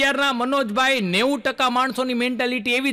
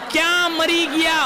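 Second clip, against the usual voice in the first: mock scolding wife; 7 semitones higher than usual. Wife yelling for her husband after fifteen years, mock scold. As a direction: snap at the husband, hammed-up. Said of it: "બેનનો tone કેવો થઈ જાય".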